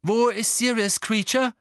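loud, high-pitched questioning; urgent demand. A narrator's reading. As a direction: demand an answer from the servant. Harry demands answer from elf.

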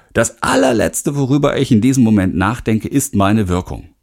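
ordinary talk

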